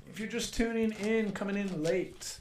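game show host. Acted. Host welcomes late viewers.

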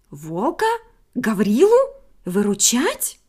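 indignant questions rising. A narrator's reading. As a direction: scoff at the very idea. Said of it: "возмутилась".

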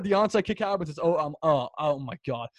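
ordinary talk, nothing marked

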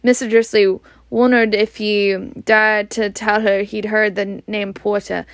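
ordinary delivery, nothing marked

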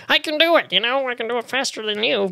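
put-on high, fast competitive kid voice; mock childish bragging in a squeaky-high pitch; boastful little kid. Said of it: "When I was a little kid".

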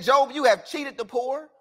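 loud, scolding accusation; accusing friend. Acted. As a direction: point the finger at Job, loudly.

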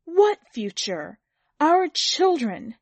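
trembling outburst. A narrator's reading. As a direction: burst out in heated protest.

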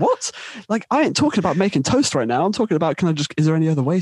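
high-pitched disbelieving comeback; incredulous. Retold or imitated. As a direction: protest in bafflement, pitch shooting up. Retold incredulous comeback to toaster remark.

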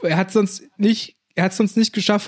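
usual speaking voice

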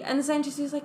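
normal talking voice

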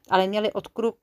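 usual speaking voice

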